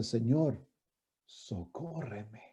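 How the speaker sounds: low, hushed, slow entreaty; worshipful plea. Retold or imitated. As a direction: plead softly, as if bowed in worship. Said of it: "ella se postra a adorarlo".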